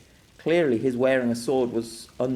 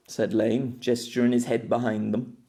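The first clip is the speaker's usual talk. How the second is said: plain reading voice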